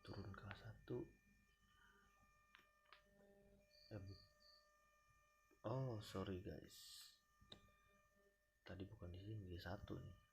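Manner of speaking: drowsy, nodding off while talking; sleepy. Real, not acted. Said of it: "aduh, sorry, ngantuk nih".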